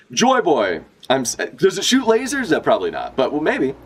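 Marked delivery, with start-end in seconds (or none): 0.1-2.6 giddy question. Acted. Voicing an excited character.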